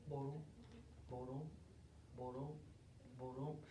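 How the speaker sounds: very low, soft tortoise steps; low, quiet plodding sound. Acted. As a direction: murmur heavy little footfalls.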